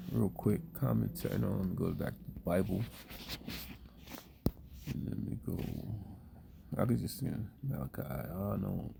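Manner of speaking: low, very quiet mumble to himself; mumbling. Real, not acted. Speaker searching for the Bible passage, mumbling to himself. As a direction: mumble it under your breath while looking something up. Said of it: "Excuse me for the mumbling earlier".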